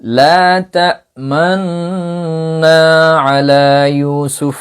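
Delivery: cantillated Quranic recitation with ishmam. Shown as a demonstration. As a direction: recite it slowly as in tilawah, lips rounding on تأمنا. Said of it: "Ismam মাআল গুন্না".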